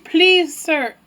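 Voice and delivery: mild pleading, high on please; a queen begging for her child, lightly voiced. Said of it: "And she begs him".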